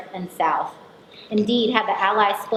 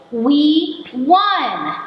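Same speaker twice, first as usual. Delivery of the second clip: high, loud triumphant yell in broken English; 7 semitones higher, 6 dB louder than usual. Retold or imitated. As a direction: shout it out like a victory cry. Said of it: "a male voice yells".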